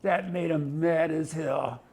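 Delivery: ordinary delivery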